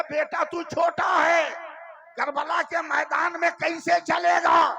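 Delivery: high, loud, slow amazed cry; astonished. A narrator's reading. Husain to his infant son, loud astonished cry. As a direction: marvel aloud at the little one. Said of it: "हैरत से कहा".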